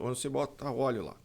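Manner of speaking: normal talking voice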